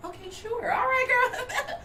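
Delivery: put-on high giggly all right girl; customer voice giving way. Shown as a demonstration. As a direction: finally let loose into girl talk. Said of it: "Voice still on".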